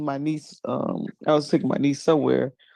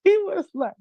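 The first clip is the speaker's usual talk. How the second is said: usual speaking voice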